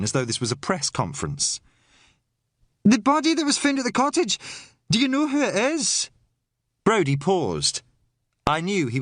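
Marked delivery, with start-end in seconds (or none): 2.8-6.1 reporter's voice. Acted. Maggie questions like reporter.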